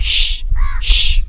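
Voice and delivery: श as a bare sustained "sh"; "sh" sound. Shown as a demonstration. Teacher shows what German sch sounds like, श as "sh". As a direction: sound out श alone, "shhh". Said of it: "तो इसका sound निकलता है".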